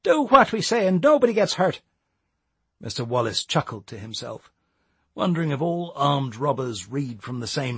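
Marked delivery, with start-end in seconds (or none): 0.0-1.8 nervous threat. Acted. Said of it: "failing to control his trembling voice".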